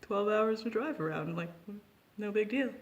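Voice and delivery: high, breezy mock shrug; shrugging off the friends' shock, mock casual; mock nonchalant. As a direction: play it off as totally normal, tongue in cheek. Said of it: "And we're like".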